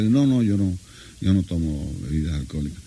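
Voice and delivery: low, quiet refusal from the guest; big-nosed guest. Retold or imitated. Big-nosed guest declines a drink.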